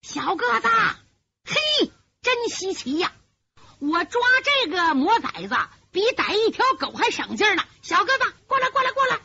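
high-pitched holler from far away; distant caller shouting. A narrator's reading. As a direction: call your partner over excitedly. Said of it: "远处有人喊".

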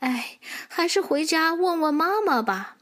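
put-on sigh, then give up in a young voice; sighing little horse. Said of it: "他叹了口气说". (小马叹着气，无奈地说)

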